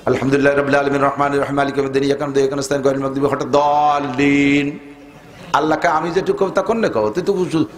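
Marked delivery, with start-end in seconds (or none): 0.1-4.8 rushed recitation. Shown as a demonstration. Preacher mocks worshippers rushing the Fatiha, reciting fast. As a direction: rattle off Fatiha in one breath, then stretch the last word. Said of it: "এক নিঃশ্বাসে মারিয়ে দিয়েছেন".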